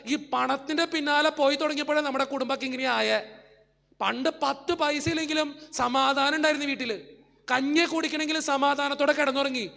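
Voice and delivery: rising pulpit fervor; fiery preaching. Acted. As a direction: preach it to full force. Preaching climax.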